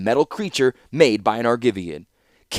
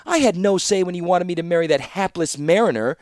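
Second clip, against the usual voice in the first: higher, heated accusation; indignant charge; 7 semitones higher than usual. A narrator's reading. Kayla accusing her father, indignant. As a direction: push back hard. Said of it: "she charged".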